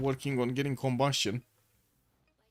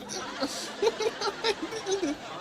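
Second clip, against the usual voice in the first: high burst of laughter. Real, not acted. After the clip's punchline.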